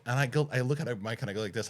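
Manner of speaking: ordinary talk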